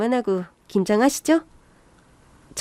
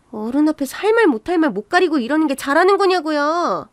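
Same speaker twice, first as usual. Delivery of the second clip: put-on higher-pitched, indignant young in-law; outraged sister-in-law; 5 semitones higher than usual. Sister-in-law defending her mother against brother's wife, outraged. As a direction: pitch up, scandalised and protesting.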